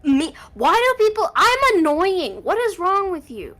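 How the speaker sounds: high, loud, slow indignant yelling; mock outrage. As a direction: shriek it in fake offense.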